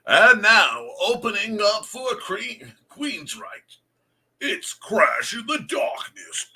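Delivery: big concert announcer, loud and high. Pretend. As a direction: hype the opening act. Mock band announcement.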